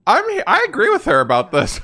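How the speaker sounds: high loud eager agreement; emphatic agreement. Real, not acted. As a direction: jump in siding with her. Siding with character emphatically.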